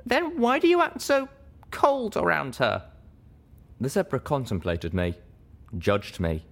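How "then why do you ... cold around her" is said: high voiced quote. Narrator voicing herself questioning.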